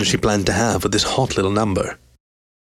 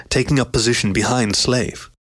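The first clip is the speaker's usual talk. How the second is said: plain reading voice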